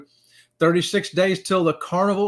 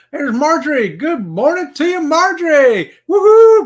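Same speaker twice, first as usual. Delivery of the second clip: loud, high cheery hello; excited greeting; 10 semitones higher, 11 dB louder than usual. Real, not acted. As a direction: call out to a friend in chat. Greeting chat viewer loudly.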